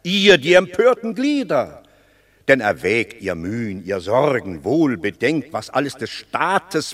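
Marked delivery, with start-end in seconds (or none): 0.0-1.8 higher and louder, proclaiming; loud declamation. Acted. Menenius thunders the fable's meaning at the rebels, loud. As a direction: hammer the moral home to the crowd. Driving home the fable's point.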